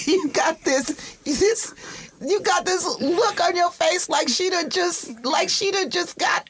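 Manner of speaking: high, squealing words through real laughter; helpless laughter. Real, not acted. Co-host teasing sister about her face, cracking up. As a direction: barely get it out for laughing. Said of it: "I am laughing".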